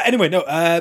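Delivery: usual speaking voice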